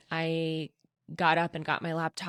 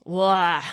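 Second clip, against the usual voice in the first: a short, louder wailing sob; imitated sob; 8 dB louder than usual. Showing listeners how her crying burst out, loud wail. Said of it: "And it was just like this".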